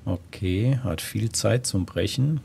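ordinary talk, nothing marked

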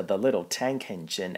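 ordinary delivery, nothing marked

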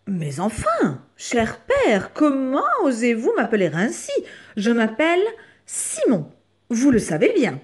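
high, loud, affronted protest; surprised exclamation. Acted. Rabbit correcting his father about his name, loud surprised protest. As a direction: protest with indignant, formal astonishment. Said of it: "fort surpris, s'exclama".

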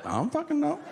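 very high, flustered protest; defensive panic. Retold or imitated. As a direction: squeak it out defensively. Defensive caller questioned by the operator.

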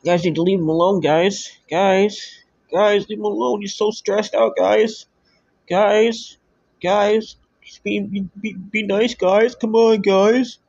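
hammed-up pleading for the poor victim; mock pleading. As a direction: beg sarcastically in a squeaky put-on voice. Mocking plea to leave Cyrax alone.

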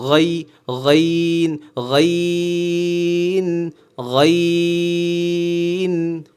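গাইন with gargled gh gliding into a long "een"; gliding ghain. Shown as a demonstration. Teacher correcting ghain, gargled start gliding onward. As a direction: move quickly off the gh, then stretch গাইন. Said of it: "ইয়ার দিকে ধাবিত করবেন".

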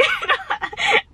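short high-pitched burst of laughter. Real, not acted. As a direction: squeal with laughter. Laughs at own joke.

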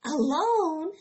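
high, loud disbelieving cry; can't believe what mother said; incredulous. A narrator's reading. Fern answering her mother about being alone, loud disbelief.

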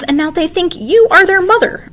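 high, loud scolding; scolding shout. A narrator's reading. Vic scolding Kavita about the dragons. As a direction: raise it to scare her.

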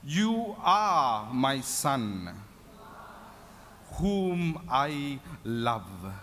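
very slow, drawn-out voice of God; voice from heaven. Acted. Preacher voices heaven speaking to the Son, very slow and solemn. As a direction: stretch each word out with solemn weight. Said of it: "And a voice from heaven".

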